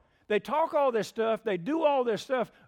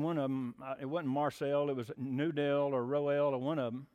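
ordinary talk, nothing marked

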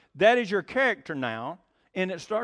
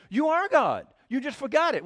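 usual speaking voice

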